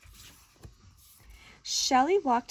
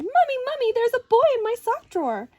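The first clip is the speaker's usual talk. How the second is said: put-on high, loud, breathless child calling Mommy; excited little girl; 10 semitones higher, 8 dB louder than usual. Girl running downstairs to tell Mommy, high and loud. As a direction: call out like an alarmed little girl.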